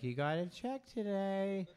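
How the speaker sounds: high, drawn-out wifely question; imitated wife. Wife asks comedian about residual checks, sweetly put-on high voice.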